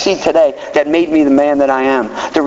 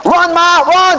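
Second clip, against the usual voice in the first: mock shout, raised high and loud; urgent call; 13 semitones higher, 4 dB louder, slower than usual. Father shouting for his wife to come, urgent call. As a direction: yell for mom to hurry. Dad calls out to mom.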